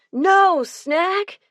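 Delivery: put-on high, dismayed kid cry; a high wail of dismay from the boys. A narrator's reading. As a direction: squeak it out like kids losing their treat. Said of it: "Den Five was dismayed".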